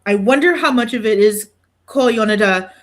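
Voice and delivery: ordinary talk, nothing marked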